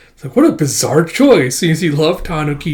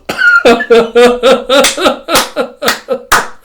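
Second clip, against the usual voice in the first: real high-pitched laughter; 11 semitones higher, 7 dB louder than usual. Real, not acted. Reader laughing at her own canceled joke. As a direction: burst out laughing.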